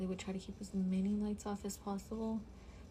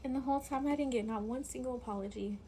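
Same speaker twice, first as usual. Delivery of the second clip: ordinary talk, nothing marked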